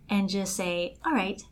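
ordinary delivery

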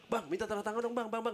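fan asking. Retold or imitated. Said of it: "gue sama Rian lagi manggung".

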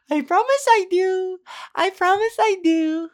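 put-on high, cooing baby talk for a pet; talking to a dog. Repeating the promise as if to a pet dog, high cooing baby talk. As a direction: coo it like praising a puppy. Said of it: "like I was talking to a dog".

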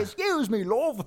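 very high, squawky British accent; shrieky British tease to annoy someone; hammed-up falsetto British; mock British accent. Shown as a demonstration. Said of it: "I say it so fucking loud".

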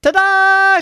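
high, loud drawn-out fanfare; triumphant ta-da. A narrator's reading. Little gymnast announces herself to the family, triumphant. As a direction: belt it out like a little show-off.

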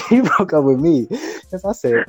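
usual speaking voice

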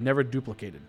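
ordinary delivery

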